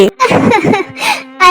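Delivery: mother bird laughing to comfort her chick; gentle laugh. Acted. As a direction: laugh it off for the child. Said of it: "ওই টুনি পাখি তখন হাসতে থাকে".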